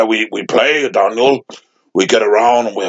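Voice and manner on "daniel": normal talking voice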